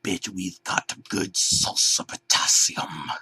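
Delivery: unvoiced sinister hiss. B one hissing a threat at the unmasked bear. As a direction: hiss it like a villain. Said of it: "B one hissed".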